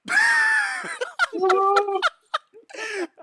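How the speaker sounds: loud high cackling laughter; shrieking laugh. Real, not acted. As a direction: burst into wild laughter. Explosive laughter at kill.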